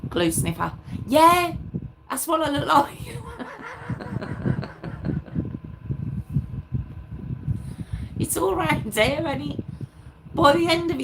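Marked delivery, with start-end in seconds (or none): 3.0-8.1 long high airy giggle; high breathy laugh. Real, not acted. Laughing at her own red nose, high giggle. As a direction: let a breathless laugh run on.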